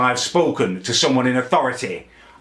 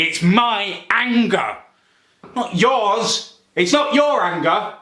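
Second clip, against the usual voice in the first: slow, higher, pressing "my anger"; stress on my; 8 semitones higher, slower than usual. Real, not acted. Telling his family it is his anger, insistent stress. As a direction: lean on "my" against "yours".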